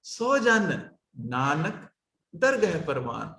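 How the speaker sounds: ਸੋ ਜਨ, pause, Nanak set apart low and soft, pause, ਦਰਗਹ ਪਰਵਾਨ; isolated Nanak. Shown as a demonstration. Teacher models the right recitation, Nanak set off between pauses. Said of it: "ਇਸ ਤਰ੍ਹਾਂ ਉਚਾਰਨ ਹੋਏਗਾ".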